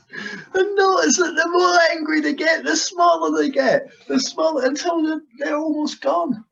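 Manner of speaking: high, loud squeal settling as it goes; high-pitched glee. Real, not acted. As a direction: squeal it out in helpless amusement. Speaking through laughter at ridiculous voices.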